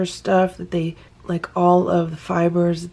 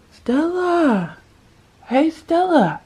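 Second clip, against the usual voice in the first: high sing-out call, falling at the end; re-enacted calling out for the cat, pitched well up; mild retold yell; 11 semitones higher, slower than usual. Owner calling her cat from bed, mock yell. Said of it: "I yelled out".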